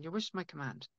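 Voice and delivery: a soft, hushed genie granting wishes. Acted. A little genie inside you, softly granting wishes. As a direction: murmur it like a genie repeating a promise. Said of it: "a little genie inside you saying".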